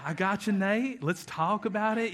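lightly imitated Southern drawl, soft and easy; a gentle hint of a Southern pastor's drawl; easygoing Southern drawl, just a touch of it. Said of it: "He talks with his southern drawl".

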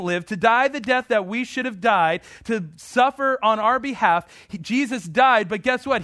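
rising sermon fervor; fervent preaching. Acted. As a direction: drive it home from the pulpit. Preaching at full force.

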